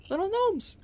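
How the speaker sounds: high squeal of delight; gasp, then squeak it high; squeaky gnome voice. Acted.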